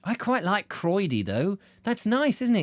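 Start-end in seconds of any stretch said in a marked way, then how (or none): none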